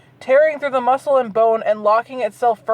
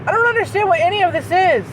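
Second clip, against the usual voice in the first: exasperated; 8 semitones higher, faster than usual. Real, not acted. Baffled by the story.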